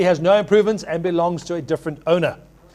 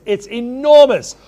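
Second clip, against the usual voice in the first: excited; 5 semitones higher, 7 dB louder, slower than usual. Real, not acted. Auctioneer hyping the property.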